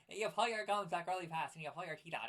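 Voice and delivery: lips pulled tight off the gums; garbled, toothy cartoon madman voice; Fire Marshal Bill. Shown as a demonstration. Co-host explains character's voice, doing it gummy and garbled. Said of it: "Fire Marshal Bill".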